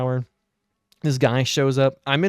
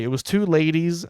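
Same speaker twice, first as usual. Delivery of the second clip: usual speaking voice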